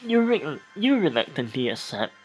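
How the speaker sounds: reading the scene aloud, a touch theatrical; game text narration. Acted. Streamer reading game text aloud to viewers, lightly narrated. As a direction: narrate the erotic scene like a storyteller.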